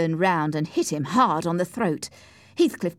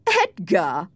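louder, mocking exclamation; scornful laugh; 2 semitones higher, 5 dB louder than usual. A narrator's reading. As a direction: toss the name out in scorn. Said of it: "he said with a laugh".

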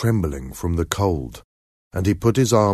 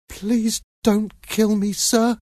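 put-on very high, slow little boy's plea; terrified pleading; 14 semitones higher, slower than usual. A narrator's reading. Seven-year-old boy begs a threatening man, terrified. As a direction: beg in a high, frightened child's voice. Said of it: "Pip said in terror".

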